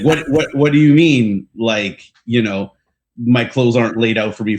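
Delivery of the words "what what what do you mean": half-acted disbelief, a bit higher and louder; mock bewildered what-do-you-mean; incredulous. A spoiled kid shocked at having nothing, mock bewildered. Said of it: "it was like".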